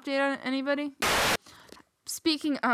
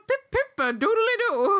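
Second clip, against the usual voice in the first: put-on high, loud, silly Irish accent; mock Irish accent; 10 semitones higher, 6 dB louder than usual. Retelling a Drake and Josh episode, mock Irish accent. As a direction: imitate a cartoonish Irish accent. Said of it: "but they had Irish accents".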